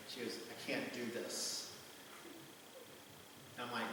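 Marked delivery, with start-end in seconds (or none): 0.5-1.7 soft, muted quote of her hesitation; retold quiet line. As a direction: say it hushed and unsure, as she did. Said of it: "she just gets real quiet".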